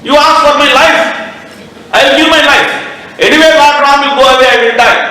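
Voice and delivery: high, pleading, anguished king; a betrayed king begging his wife, pitched high. Acted. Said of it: "then said".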